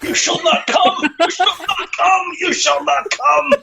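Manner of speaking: high, slow, booming mock wizard cry; mock Gandalf shout. Friend hams up a wizard's shout at the actor, mock booming. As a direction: bellow it like a wizard blocking the bridge, joking.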